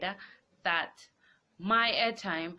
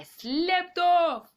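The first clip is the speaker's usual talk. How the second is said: drawn-out emphasis; 11 semitones higher, 10 dB louder than usual. Real, not acted. Dozing off, drawn out for effect.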